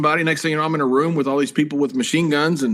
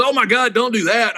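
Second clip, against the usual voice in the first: higher-pitched retold dismay; imitated alarm; 7 semitones higher, 3 dB louder than usual. Man warning the detained wrestler, mock alarmed. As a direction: react as if he has blundered. Retelling the man's alarmed reaction.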